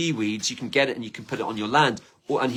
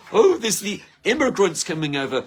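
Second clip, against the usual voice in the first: a higher, louder mock grumble; mock complaining; 6 semitones higher, 4 dB louder than usual. Poking fun at people who complain, mock grumbling. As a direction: send up the moaning neighbour.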